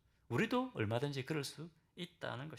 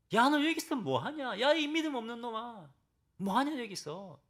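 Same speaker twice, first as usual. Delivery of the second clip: mock scolding; 9 semitones higher, 5 dB louder than usual. Pretend God scolding Elijah, high and loud mock rebuke. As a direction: bark at him like an impatient master, hammed up. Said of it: "엘리야를 꾸짖지 않으십니다".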